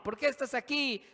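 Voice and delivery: high-pitched, insistent questioner; prisoner interrogating. Acted. Inmates asking each other.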